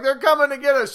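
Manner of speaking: high, loud mock panic; mock scared. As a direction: pretend terror, hammed-up. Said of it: "She's not like".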